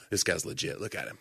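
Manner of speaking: low, quiet awed murmur; hushed admiring aside. Retold or imitated. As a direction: lean in and drop the voice. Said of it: "They go".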